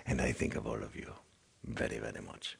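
hushed, unvoiced aside to viewers; whispered aside. Real, not acted. Whispering an aside to the viewers about tips.

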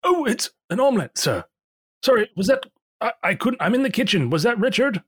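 high, flustered call from the kitchen; flustered innkeeper. Acted. Pierre calling from the kitchen.

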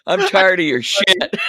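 loud, half-joking rant; telling off a rival, hammed up; mock angry outburst. Imagined apostle telling off.

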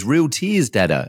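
high, childlike imitation of a small girl; little daughter's voice. Father retelling his daughter's words, in a high childlike voice. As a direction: lightly voice a wondering little girl. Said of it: "my daughter, looks at me and says".

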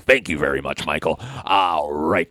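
low poor man's Beetlejuice; [name]. Pretend. [name] thanking the host who handed over, low put-on voice. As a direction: drop into a low showman character. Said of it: "You sound like a poor man's".

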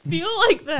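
normal talking voice

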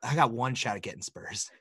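low, quiet mock scheming aside; mock the friend's secret plan, low and hushed; lightly voiced conspirator; imitating co-host. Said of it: "'Cause you're like".

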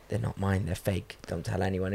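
acted hushed confession to a kid; confiding, hushed. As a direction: keep it down so nobody overhears. Confiding a secret to a child.

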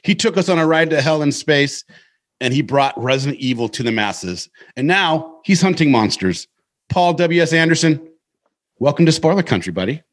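upbeat podcast host welcome; pumped, high-energy show opener; host intro. Acted.

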